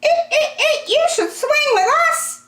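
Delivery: very high monkey squeaks with a giggle; cheeky monkeys chattering; squealing monkeys. Acted. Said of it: "said the monkeys".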